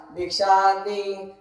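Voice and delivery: very slow, stretched cry for alms; alms-seeker's call. Acted. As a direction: draw the words out into a level doorstep chant. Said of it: "पुन्हा एकदा आवाज दिला". (भिक्षेसाठी लांबवलेली हाक)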